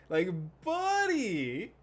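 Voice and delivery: loud, high, drawn-out cry; stretched-out, incredulous yell; exasperated shout. Real, not acted. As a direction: call out a friend in disbelief. Exasperated call-out of Sora.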